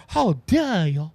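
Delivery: slow, drawn-out mock outrage; stretched-out scandalized mimicry; Greta Thunberg. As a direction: imitate an indignant scolding. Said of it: "uh, Grinberg".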